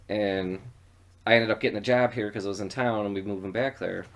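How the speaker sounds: ordinary talk, nothing marked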